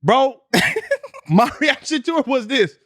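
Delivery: high laugh into words. Real, not acted. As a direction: laugh then say it.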